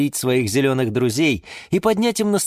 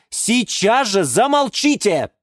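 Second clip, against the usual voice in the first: high, slow, emphatic order; losing patience; 9 semitones higher, slower than usual. A narrator's reading. Юра orders bickering plants silent, exasperated. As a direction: demand silence, at the end of patience. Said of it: "не выдержал Юра".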